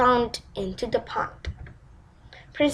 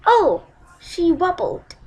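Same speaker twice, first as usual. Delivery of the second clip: plain reading voice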